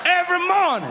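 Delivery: high-pitched aggressive convict act; threatening prisoner. Acted. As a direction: terrorize a kid in a high put-on voice. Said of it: "He's like".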